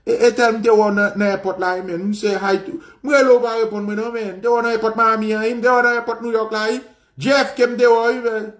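lightly voiced boastful schoolkid; mock bragging kid. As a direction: rattle off the airports like a bragging kid. Mocking kids bragging about trips.

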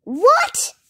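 high, loud, rising disbelief; incredulous shout. A narrator's reading. Dodsworth hearing the duck can't swim, shouted. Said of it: "shouted Dodsworth".

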